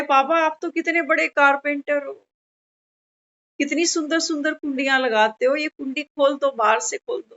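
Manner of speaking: a hint of a little kid begging papa; child pleading with papa to open the latch, mildly voiced. Child pleads with carpenter father.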